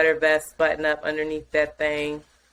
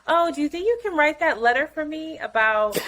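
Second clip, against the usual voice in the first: high, singsong call without real shouting; calling into next room; 8 semitones higher than usual. Retold or imitated. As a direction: call through to your partner in the other room. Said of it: "I yell into him".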